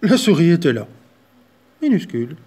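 spring the mouse on them; surprised reveal. A narrator's reading.

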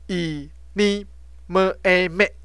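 以尼么诶美 very slowly, syllable by syllable; teacher spelling out 以尼么诶美, drawn out; sounded-out syllables. Shown as a demonstration. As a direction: stretch each piece, blending 么诶 into 美. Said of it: "M E是美哈".

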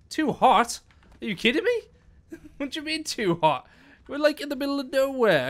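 high, laughing disbelief; high-pitched are you kidding me; incredulous. Real, not acted. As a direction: protest that it makes no sense. Egg said too hot.

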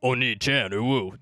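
lightly voiced goofy alternative Oni-chan; other silly voice. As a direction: say it in an odd altered voice at normal pitch. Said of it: "Or I'll go like".

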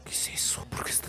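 whispered disbelief; breath-only, awed whisper; hushed whisper. Real, not acted. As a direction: whisper it, stunned. Awed by huge crocodile in video.

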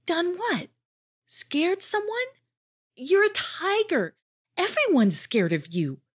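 high, incredulous little voice; a small creature baffled; puzzled Jardad. A narrator's reading. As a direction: Jardad can't believe it.

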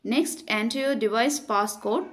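usual speaking voice

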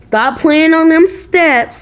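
loud, high retold telling-off; scolding mom. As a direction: lay down the law to a child. Mother retells scolding her child.

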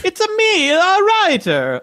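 very high, slow mock video game plumber; Mario. Co-host jokingly voices Mario as the writer, very high. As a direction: do a cartoon Italian plumber. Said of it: "So is it Mario?".

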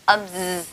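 drawn-out buzzing "z"; "âm z" with a long voiced z. Shown as a demonstration. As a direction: hold a humming z in "âm z". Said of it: "nó sẽ tạo ra âm thanh".

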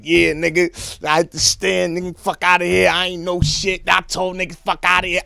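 loud, high cocky bragging; hyped-up victory shout; triumphant boasting. Retold or imitated. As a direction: crow about it to the block. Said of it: "I'm coming back to the island like".

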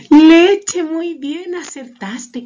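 high, loud burst of delight, easing off; excited praise. Pretend. Teacher congratulating children on acertaste, excited cheer. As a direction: cheer the right answer.

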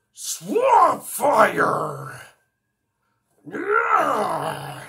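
loud, high triumphant battle cry; alien hero cry. Acted. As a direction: shout the name like transforming. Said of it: "some Ben Ten alien voices".

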